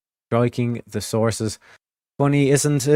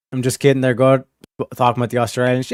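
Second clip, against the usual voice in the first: normal talking voice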